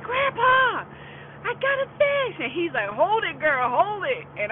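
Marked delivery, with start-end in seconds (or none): 0.0-2.4 high, loud call for help; excited yell. Retold or imitated. Child calls to grandpa with a fish on the line, excited yell. 2.9-4.3 higher, louder retold holler; urgent calling out. Grandpa tells the girl to hold the rod, urgent calling out. Grandpa calls instructions to her.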